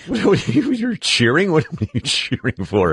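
incredulous. Real, not acted. Host teases co-host cheering.

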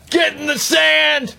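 high, drawn-out heckler's shout; imitated crowd yell. Golf fan heckling player from gallery, yelling. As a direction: yell it like a fan jeering a golfer. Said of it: "when people are yelling".

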